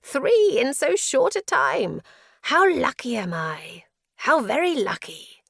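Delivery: high, loud, manic delight; exclaimed. A narrator's reading. Prisoner rejoices at three visitors, exclaiming. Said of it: "he exclaimed".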